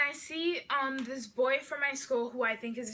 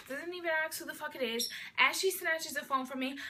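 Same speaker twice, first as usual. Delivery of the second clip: ordinary delivery, nothing marked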